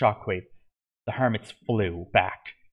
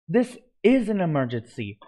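urgent yell, a bit higher and louder; 7 semitones higher, 4 dB louder than usual. A narrator's reading. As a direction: call it out to the crowd as an alarm. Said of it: "yelled Grian".

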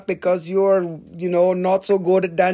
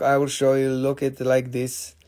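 ordinary talk

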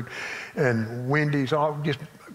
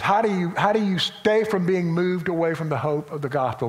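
usual speaking voice

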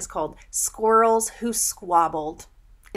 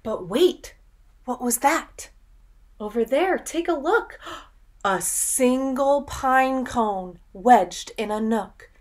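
excited discovery; 4 semitones higher, 5 dB louder than usual. Acted. Builds suspense in story.

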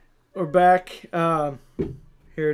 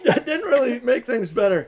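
high, loud laughing complaint; 6 semitones higher, 6 dB louder than usual. Real, not acted. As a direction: laugh through the burn. Burning after pickle juice.

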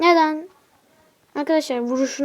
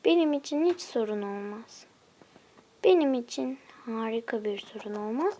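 ordinary talk, nothing marked

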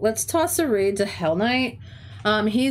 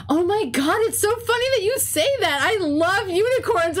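high, gushing mock sub voice, much higher; 10 semitones higher than usual. Teacher mimics the substitute gushing over unicorns, high squeal. Quoting the sub's gushing reply.

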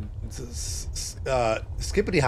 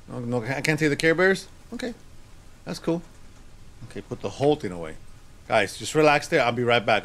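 ordinary delivery

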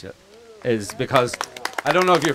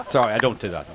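usual speaking voice

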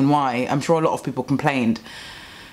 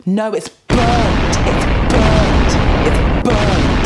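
shouted rant; 7 semitones higher, 8 dB louder, much slower than usual. Real, not acted. Vlogger rants at restaurants over burnt burgers, shouting. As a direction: shout "burnt" over and over, slow and much louder.

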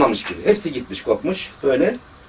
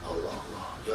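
whispered; 11 semitones lower, 17 dB quieter, slower than usual. Retold or imitated. The dying aunt's quiet sigh.

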